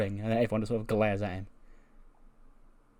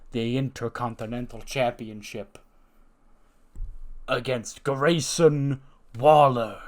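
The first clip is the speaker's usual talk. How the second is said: acted announcement; slower than usual. Boss revealing who gets the title match, drawn-out announcement. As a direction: spin it out for suspense.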